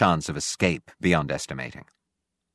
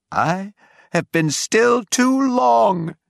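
high, drawn-out declaiming shout; loud ranting cry; 13 semitones higher, 4 dB louder, much slower than usual. A narrator's reading. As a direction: cry it out slowly so the whole pit hears. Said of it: "in a tone that must have reached the pit".

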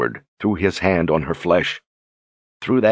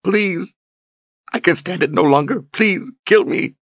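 desperate begging through tears; 13 semitones higher than usual. A narrator's reading. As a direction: beg him, breaking down. Berdine weeping, begging to die.

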